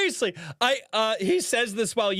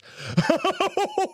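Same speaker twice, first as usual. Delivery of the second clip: bursting laugh; 13 semitones higher than usual. Real, not acted. Streamer reacting to a viewer's comeback, bursting laugh. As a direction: explode into a high laugh.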